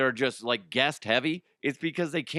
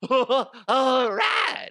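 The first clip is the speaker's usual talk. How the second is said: high, loud clown "all right"; 13 semitones higher, 6 dB louder than usual. Acted. Host tests his clown voice, high and loud. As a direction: squeal it like a clown warming up. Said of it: "I'm trying to find my clown voice".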